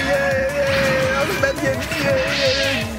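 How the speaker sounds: high, loud gleeful yelling; excited cheer. Real, not acted. Celebrating in game.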